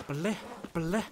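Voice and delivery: "Bleh, bleh" flicked off the roof of the mouth; tongue-flick demo. Shown as a demonstration. Streamer showing the Scottish r, flicked "Bleh, bleh". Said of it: "Kinda like that".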